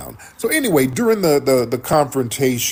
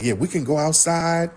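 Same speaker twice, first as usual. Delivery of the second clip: higher-pitched imitated challenge; retold challenge; 6 semitones higher than usual. Anton inviting Corey outside, retold challenge. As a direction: act out someone offering a fight, pitched up. Retells Anton's offer to fight.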